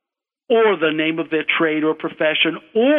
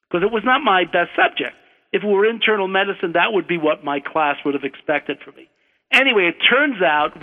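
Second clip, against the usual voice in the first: usual speaking voice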